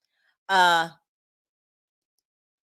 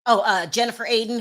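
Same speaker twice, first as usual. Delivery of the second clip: ordinary talk, nothing marked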